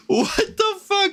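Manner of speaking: high, squeaky laugh through the words; slow, high-pitched laughing disbelief; incredulous laughing. Real, not acted. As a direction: crack up in disbelief, drawn out. Mock outrage at his own story.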